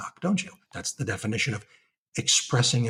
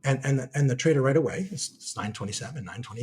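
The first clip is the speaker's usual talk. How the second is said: usual speaking voice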